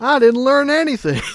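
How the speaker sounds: high mock cartoon pony; imitating a cartoon pony. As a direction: squeak it like a cheerful cartoon pony admitting defeat. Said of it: "Love the way she says it".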